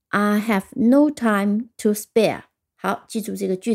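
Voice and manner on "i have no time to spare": very slow, each word held; model sentence reading. Shown as a demonstration. Teacher repeating the sentence for learners, slow model reading. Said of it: "再听一遍".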